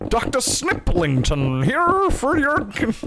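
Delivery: high, slow, drawn-out mock announcement; theatrical high-pitched proclamation; hammed-up announcement. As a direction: stretch it out high, savoring the joke name. Acting out sudden realization of joke.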